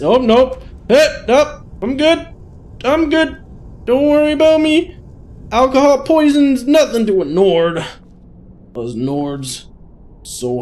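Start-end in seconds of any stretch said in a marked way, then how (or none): 0.0-7.8 very high, loud, squealed insistence; mock drunken bravado. Drunk Nord insisting alcohol poison is nothing, mock high-pitched bravado.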